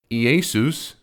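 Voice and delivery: Iesus said as ee-YAY-soos; Greek pronunciation. Shown as a demonstration. Explaining the name's Greek form to viewers, Iesus as ee-YAY-soos. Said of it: "is the Greek way of saying".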